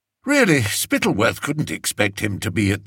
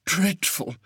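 high, quieter shudder, trailing off; shuddering softly; 8 semitones higher, 4 dB quieter than usual. A narrator's reading. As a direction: let the second dreadful fade with a shiver. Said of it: "said Fred with a shudder".

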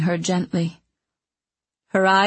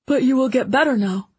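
put-on higher, louder, fast boyish insistence; insist, fighting off fear; earnest boy; 6 semitones higher, 4 dB louder, faster than usual. A narrator's reading. Boy insists to dying mentor.

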